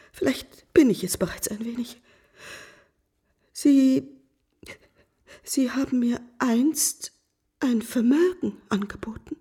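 hushed, tearful admission; tearful whisper. Acted. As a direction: confess it in a broken whisper. Wife confesses despair, near tears.

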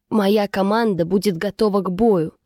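ordinary delivery

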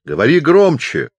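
loud, high deaf man's shout. A narrator's reading. Hard-of-hearing chaplain demands the visitor speak up, shouting. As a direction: holler it like someone hard of hearing. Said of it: "заорал капеллан".

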